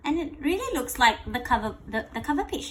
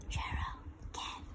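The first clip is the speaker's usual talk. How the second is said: hushed whisper; 16 dB quieter than usual. Acted. Animals gasp in surprise.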